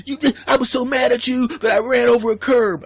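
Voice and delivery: hammed-up girlfriend tantrum; mock angry girlfriend. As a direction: play the furious girlfriend. Girlfriend voiced again.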